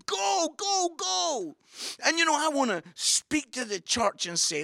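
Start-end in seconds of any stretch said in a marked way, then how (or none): 0.0-1.5 high, eager cheer; excited urging. Acted. Preacher cheering on the women running, high excited urging. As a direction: urge them on, pitched way up.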